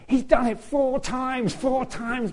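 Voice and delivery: teasing bandmates. Retold or imitated. Bandmates teasing him on stage.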